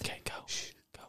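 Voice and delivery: hushed unvoiced whisper. Real, not acted. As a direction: hush them to go on. Hushing during ASMR bit.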